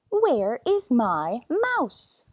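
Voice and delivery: the cat demanding his mouse. Pretend. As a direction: drawl it like a greedy cat. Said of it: "if Simpkin had been able to talk".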